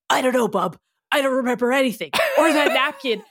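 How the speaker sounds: lightly voiced gruff-talking tough character; Logan. A narrator's reading. Logan tells Ryan he remembers nothing, lightly in character. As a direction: put on a touch of tough-guy voice. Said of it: "I always love Maddie's Logan voice".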